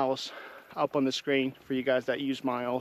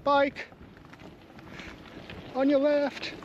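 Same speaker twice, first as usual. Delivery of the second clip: calling out a warning; 10 semitones higher, slower than usual. Real, not acted. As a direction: call it out so everyone hears. Warning of cyclists on the trail.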